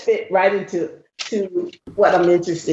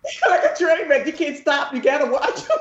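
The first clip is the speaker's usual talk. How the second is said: talking through real laughter, higher; laughing; 6 semitones higher than usual. Real, not acted. Co-host likening the commercials to a train wreck, laughing. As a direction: laugh while getting the words out.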